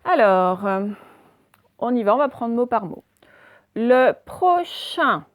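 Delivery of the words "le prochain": very slow dictation of "Le prochain"; syllable by syllable. Shown as a demonstration. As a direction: stretch each syllable of "Le prochain". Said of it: "on dit bien le prochain".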